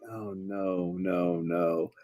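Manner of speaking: low, hushed muttering to himself; mock-whispered dread under the breath; whispering. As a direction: drop very quiet and low. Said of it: "He's just whispering it".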